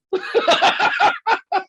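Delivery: high, loud burst of laughter. Real, not acted. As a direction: laugh hard at your own story. Laughs at the memory.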